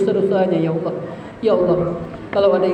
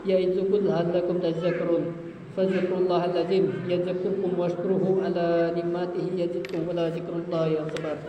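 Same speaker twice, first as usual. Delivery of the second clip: closing Arabic recitation; 7 dB quieter than usual. Real, not acted.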